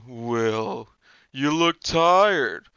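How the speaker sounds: slow, taunting villain voice, drawn out on the middle; sinister Freddy voice. Retold or imitated. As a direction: play the dream killer luring a tired kid. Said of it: "three different voices".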